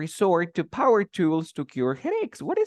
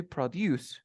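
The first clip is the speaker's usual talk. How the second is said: produce said pro-DUCE; stress on final syllable; 5 dB quieter than usual. Shown as a demonstration. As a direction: land on the end of produce. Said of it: "The stress goes at the end of the word".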